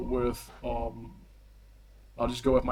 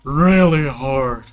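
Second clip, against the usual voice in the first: dim brute; 4 semitones higher, 10 dB louder than usual. Acted. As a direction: boast like a proud dimwit. Dim brute boasting, headache.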